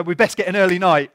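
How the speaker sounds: loud call to bed; 1 mock parent call. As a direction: announce it to the house. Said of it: "You go".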